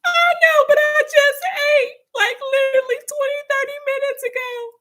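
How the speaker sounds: very high, loud crying shout; crying wail. Retold or imitated. Teen self confessing she just ate, crying wail. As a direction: wail it out high through tears. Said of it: "I started crying".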